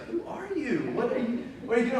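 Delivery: wife asking why he's fretting at bedtime, incredulous; imitating wife. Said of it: "And she's like".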